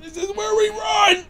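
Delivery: very high squeaky cry; panicked squeal. Real, not acted. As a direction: squeal it while fleeing. Fleeing danger in game.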